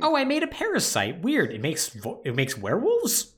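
high, lightly surprised musing over each result; a hammed-up puzzled Miranda shrugging off weird results; mock surprised scientist. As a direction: play her as casually baffled by her own creations. Mocking Miranda's failed experiments.

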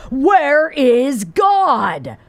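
very slow, heavy Where is God; sterner preacher. A narrator's reading. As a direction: drag each word out, stern. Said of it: "in even sterner tone".

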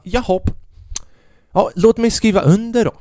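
feigned eagerness to go along; mock compliance. Sarcastic retelling of accepting the fine.

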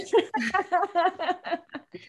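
high-pitched burst of laughter; real, high laughing fit. Real, not acted. Laughs after apology to parents.

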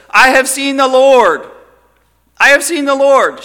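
high, loud joyful cry; excited. Retold or imitated. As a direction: burst out with the news. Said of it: "She was so excited".

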